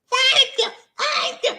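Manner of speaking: very high squeaky thanks; comic falsetto. Pretend. Host thanking his brother in chat, comic falsetto.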